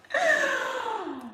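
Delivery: high-pitched falling laugh; high peal of laughter. Real, not acted. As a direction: let out one long, high laugh. Laughing at own remark.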